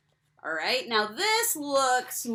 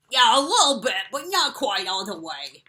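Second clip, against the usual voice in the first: penguin puppet voice; 3 semitones higher, 9 dB louder than usual. Acted. Puppet Pete answers.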